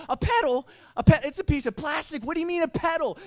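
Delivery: high, loud disbelieving question; can't believe the advice; incredulous retort. Retold or imitated. Older brother baffled by his brother's paddling tip, high incredulous cry. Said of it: "And I'm like".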